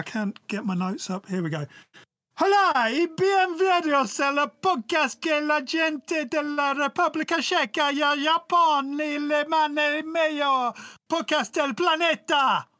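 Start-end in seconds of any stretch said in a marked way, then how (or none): 2.4-12.7 high, hammed-up Spanish announcement; Borat-like Spanish accent. Podcaster welcoming Honduras listeners, hammed-up Spanish accent like Borat. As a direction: belt out the greeting high in a put-on Spanish accent. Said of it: "the return of Borat".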